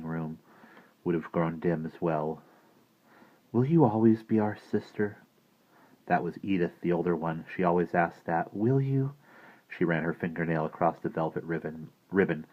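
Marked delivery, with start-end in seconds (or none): 3.5-5.2 lightly voiced little girl, higher pitch; child's question. A narrator's reading. Older sister asks Maggie to stay their sister, childlike question. Child character asking in story. 8.5-9.1 put-on higher-pitched little girl pressing; child's question. A narrator's reading. Older sister asks Maggie once more, childlike pleading.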